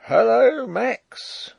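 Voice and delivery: guard hailing a comrade from afar; shouted hail. A narrator's reading. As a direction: call out across the moat. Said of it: "I heard shouted".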